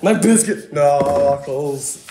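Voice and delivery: slow, loud, strange put-on voice; silly character voice. Playing a Mario Kart character in a slow goofy voice. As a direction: drag out every word in an odd voice. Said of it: "he's like".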